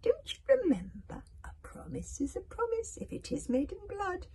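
high, whispery witch; sly witch calling in a promise. A narrator's reading. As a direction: purr it high and menacing. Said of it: "purred the witch".

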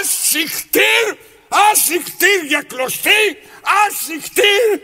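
slow, very high loud yell; stretched, shouted shoo-away. Acted. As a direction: drive it off with a shout. Shoos the thread away.

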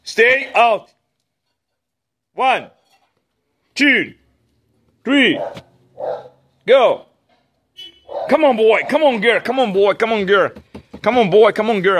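loud high countdown and calls; excited calling. Real, not acted. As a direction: cheer the dogs on. Releasing dogs with countdown.

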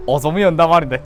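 normal talking voice

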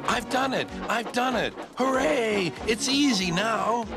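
high-pitched triumphant cheer; jubilant. A narrator's reading. James thinking he has topped the hill, jubilant.

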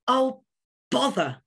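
glum. A narrator's reading. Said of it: "thought Winston glumly".

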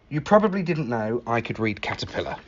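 ordinary delivery, nothing marked

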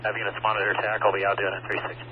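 muffled radio transmission, quiet and fast; crackly handheld radio report from scene; urgent firefighter radio. Real, not acted. Crew radios from fire scene.

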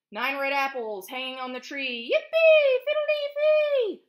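big bright storybook chant, shouted yippee; gleeful refrain. A narrator's reading. Teacher reading to preschoolers, loud gleeful chant.

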